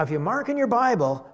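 normal talking voice

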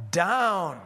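"down" loud, with a firm fall; emphasis on "down". Shown as a demonstration. Preacher reading where Jonah had gone, emphatic "down". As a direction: hammer "down" as the key word. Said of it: "I'll emphasize the key words".

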